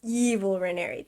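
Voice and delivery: usual speaking voice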